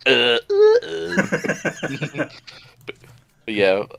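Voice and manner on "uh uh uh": high, dim-witted "uh" noises; derpy grunts. Retold or imitated. Contestant pulling the derpiest face, imitated derpy grunts. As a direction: grunt stupidly in short separate bursts. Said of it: "derpiest face contest".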